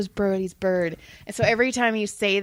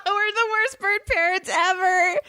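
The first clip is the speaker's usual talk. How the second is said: laughing through the words; cracking up while saying it; giggling confession about the birds; 9 semitones higher than usual. Real, not acted. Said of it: "Oh, fuck you".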